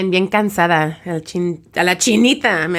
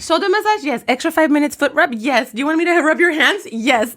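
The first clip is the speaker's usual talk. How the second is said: much higher, eager offering voice for each question; nail tech offering; 7 semitones higher than usual. Retold or imitated. Retelling the nail tech upselling her, much higher voice. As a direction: play the salon worker upselling, pitched up high.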